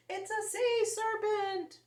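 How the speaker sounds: high, slow, loud scream of alarm. A narrator's reading. Beachgoer screaming at the seaweed monster, high and slow. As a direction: shriek it out in panic. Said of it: "one of them screamed".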